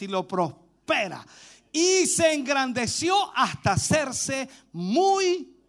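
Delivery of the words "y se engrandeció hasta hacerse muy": very slow, spaced-out scripture reading; lectura proclamada. Real, not acted. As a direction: read the verse out phrase by phrase, drawn out. (lectura bíblica solemne, pausada y alargada)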